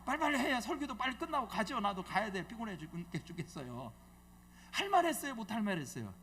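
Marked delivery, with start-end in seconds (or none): 4.7-6.1 louder call to the crowd. Shown as a demonstration. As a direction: ask again, loud and pushy. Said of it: "얘기 좀 시원하게 좀 해줘요".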